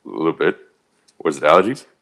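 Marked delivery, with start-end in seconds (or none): none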